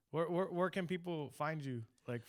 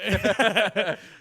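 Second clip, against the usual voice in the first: loud laughter; 7 semitones higher, 15 dB louder than usual. Real, not acted. Host laughs along.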